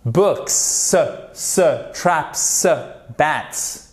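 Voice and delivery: BOOKS, TRAPS, BATS with isolated hissed s; final S sound. Shown as a demonstration. Teacher drills the s ending for students, demonstrative pronunciation. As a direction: separate each word and its s. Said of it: "Soit un se".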